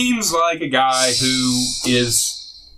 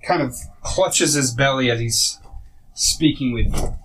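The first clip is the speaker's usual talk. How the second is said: ordinary talk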